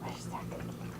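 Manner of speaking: unvoiced whisper. Real, not acted. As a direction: whisper it teasingly. Teasing dog with a treat.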